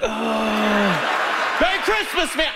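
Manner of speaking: high, laughing shouted holiday greeting; mock shout. To a driver passing by, a high laughing holiday yell. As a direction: yell it cheerfully at a passing car.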